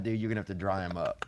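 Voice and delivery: ordinary talk, nothing marked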